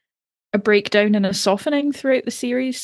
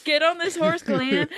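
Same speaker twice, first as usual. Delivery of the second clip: bossy mock Maggie; 8 semitones higher than usual. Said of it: "echoes the co-host's Maggie line".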